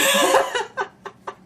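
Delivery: laughing fit; burst of laughter. Real, not acted. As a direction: crack up laughing. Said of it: "I can't do it".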